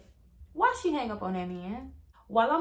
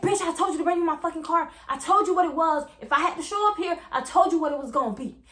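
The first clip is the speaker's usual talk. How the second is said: put-on higher and louder, furious yelling at his girlfriend; angry boyfriend; 5 semitones higher, 4 dB louder than usual. Boyfriend yelling at girlfriend for his keys, furious. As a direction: bark it like a furious man demanding his car.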